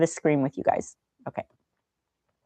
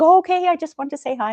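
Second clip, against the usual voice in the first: high, louder, sheepish oh okay; mock deflated boss, pitched up; imitating the CFO; 11 semitones higher, 5 dB louder than usual. CFO answers her brush-off, high and sheepish. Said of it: "And he'd be like".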